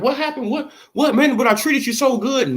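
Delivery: a mock hurt promoter asking what went wrong; Bob playing innocent. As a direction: act wounded and baffled as Bob. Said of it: "And Bob be like".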